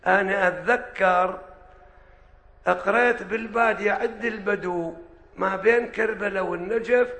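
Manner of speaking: usual speaking voice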